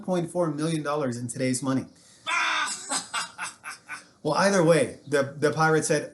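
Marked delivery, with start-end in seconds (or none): 2.2-4.0 high, hammed-up mocking laugh. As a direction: cackle in their faces, well above the usual voice. Caesar laughing at pirates.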